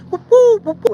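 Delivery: high hooting bird call, whoop woo; imitated bird call. Camper imitating the strange birds heard in the woods, high hooting call. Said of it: "this doggone bird is going".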